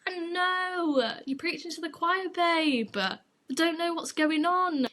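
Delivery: high, drawn-out mock wail; theatrical whining despair at oneself; exasperated agreement. Mock lament to her disapproving friends.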